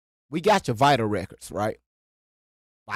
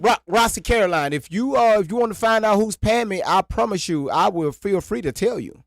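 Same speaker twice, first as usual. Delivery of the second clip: raised voice; 6 semitones higher, 4 dB louder than usual. Real, not acted. Heated call-out to Roxy.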